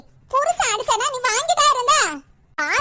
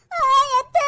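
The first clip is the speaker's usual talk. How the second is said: loud groan of pain into a weak call; pained cry; 5 semitones higher, 4 dB louder, slower than usual. Acted. Beaten woman wakes and calls her aunt, crying out in pain. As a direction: cry out as you come to.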